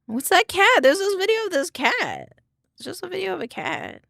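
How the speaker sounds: high, loud delighted squeal; excited high voice. Real, not acted. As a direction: gush over a cute cat. Excited finding a cat video.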